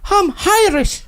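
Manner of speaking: Irish accent. Shown as a demonstration. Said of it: "It's not bad".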